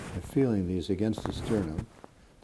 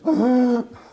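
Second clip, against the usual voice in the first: loud, high honking gasp on the in-breath; imitated stridor; 11 dB louder than usual. Shown as a demonstration. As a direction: honk like a Canadian goose as you breathe in. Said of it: "Sounds like the honking of Canadian geese, kind of like this".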